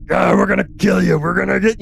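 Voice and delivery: high, fast battle yell; screaming and yelling. Acted. Arcanists fighting the coral golem, high acted yelling. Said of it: "screaming and yelling".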